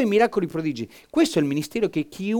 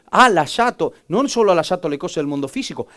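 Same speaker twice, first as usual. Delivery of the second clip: usual speaking voice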